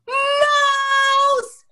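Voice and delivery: very high, loud shriek; scream. A narrator's reading. Ronald screaming again to be heard, very high shriek. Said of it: "he screamed again".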